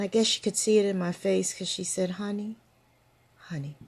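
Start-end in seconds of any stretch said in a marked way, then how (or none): 2.1-3.8 soft, quiet soothing call; tender honey. Retold or imitated. Old friend soothing a sad younger woman, soft and tender. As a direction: ease the word out gently to comfort.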